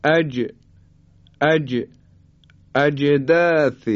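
اج with the ج bounced into a short echo; slow اجداث, popping the still ج; qalqalah on jim. Shown as a demonstration. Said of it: "حالا به حرف جیم توجه بفرمایید".

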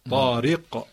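طارق slow, with pressure on the final q; pressed qalqala. Shown as a demonstration. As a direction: draw out طارق and press its bouncing ق. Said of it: "یه فشارم به قلقله بده". (طارق کشیده، با فشار روی قلقلهٔ قاف)

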